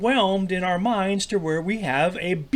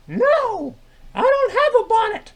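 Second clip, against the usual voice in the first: high-pitched puppet sidekick; 4 dB louder than usual. Acted. Troy answers Milt's greeting in a very high sidekick voice.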